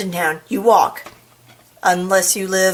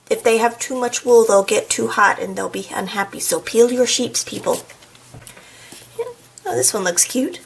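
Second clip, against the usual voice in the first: usual speaking voice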